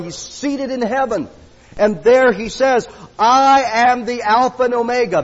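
high, loud preaching; fiery preaching. Acted. Preacher stirring up the congregation, loud and high. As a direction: preach it out to the back row.

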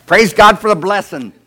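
very loud, high hallelujah cry; hammed-up shout of praise; mock praise shout. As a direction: burst out in exaggerated rejoicing. Mock cheer for late-life baby.

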